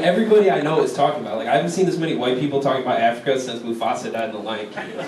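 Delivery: ordinary delivery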